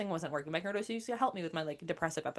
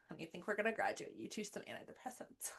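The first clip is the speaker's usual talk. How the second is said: gentle midwife; 2 semitones higher, 9 dB quieter than usual. Retold or imitated. Midwife's gentle verdict on phone.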